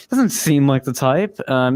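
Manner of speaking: ordinary talk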